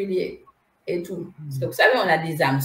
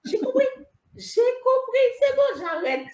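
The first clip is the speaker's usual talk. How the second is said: high pleading surrender. Retold or imitated. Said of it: "J'ai dit".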